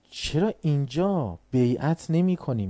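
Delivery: ordinary talk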